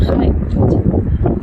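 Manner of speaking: slow breathy whisper of dismay; whispered dread, slowed right down; overwhelmed whisper. Real, not acted. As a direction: let it out slowly, almost voiceless. Overwhelmed recalling the rush.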